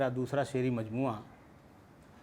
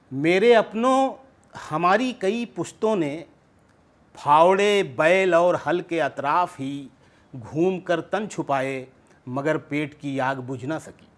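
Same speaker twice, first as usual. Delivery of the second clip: measured nazm recital with raised opening calls; poetry recitation; 5 dB louder than usual. Acted. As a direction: recite verse to an audience, falling away at the close. Recites his poem at mushaira.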